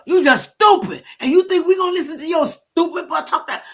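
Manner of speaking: high, loud scolding; retold telling-off. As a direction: lay into him again in the retelling. Retelling chewing out a guy.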